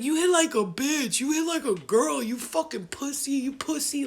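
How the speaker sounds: high, loud taunting rant; cursing him out. Retold or imitated. Hit woman taunting her attacker, cursing him out. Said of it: "Like, I'm cursing him out".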